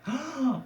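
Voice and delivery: high, drawn-in gasp; mock crowd gasp. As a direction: imitate a crowd's shocked gasp. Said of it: "they all gasp".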